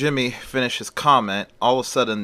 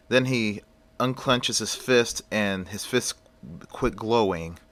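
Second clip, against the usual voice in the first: ordinary delivery, nothing marked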